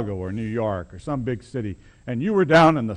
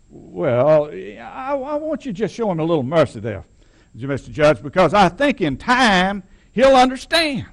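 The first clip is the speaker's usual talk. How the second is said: mock plea for mercy; 7 semitones higher, 3 dB louder than usual. Old man pleading with the judge.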